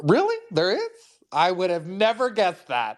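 high-pitched mock astonishment; heavy sarcasm. Writer feigning surprise at his political story, heavy sarcasm. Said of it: "Notice the sarcasm".

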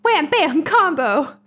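loud, high fighting-game combo call; game announcer. Pretend. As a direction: yell it like an arcade announcer.

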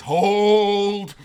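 a huge, high battlefield shout of command; army captain bellowing hold; commander's shout. Retold or imitated. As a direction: hold the line with everything you've got. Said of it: "And he says".

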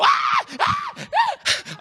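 very high startled shrieks; hammed-up high-pitched screams; mock scream. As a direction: scream as if a ghost jumped out. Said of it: "then she go".

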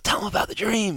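high, pushy whisper-to-the-ear push; quoted urging. Retold or imitated. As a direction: nudge him to tell it. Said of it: "the lady ... saying".

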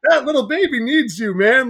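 loud, high, urging the new dad to do better; fired-up street pep talk. Retold or imitated. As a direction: imitate him calling you out, big and loud. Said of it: "He's like".

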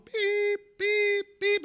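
high, drawn-out mock beeps of a reversing truck; imitated truck beeping. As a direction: beep like a tow truck backing up.

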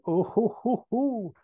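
high, rising "oh-ho-ho"; mock-naughty hooting laugh; saucy chuckle. Austin winking down from the sky, saucy chuckle. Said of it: "winks with a ding and says".

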